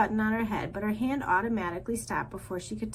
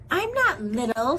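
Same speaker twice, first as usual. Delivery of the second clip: snapped; 4 semitones higher, 6 dB louder than usual. A narrator's reading. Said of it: "snapped Thorn under his breath".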